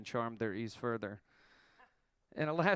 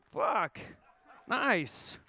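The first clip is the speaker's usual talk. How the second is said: excited; 8 semitones higher, 4 dB louder than usual. Real, not acted. Excited reaction to Rolling Stone.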